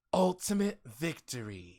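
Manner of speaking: slow, dramatic edgy hedgehog voice; drawn out like a brooding antihero; Shadow impression. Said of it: "have you heard my Shadow impression".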